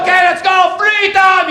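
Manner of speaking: high, loud charging shout; acted rallying war cry; battle cry. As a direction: yell it as you charge into battle. Acting a soldier's battle charge.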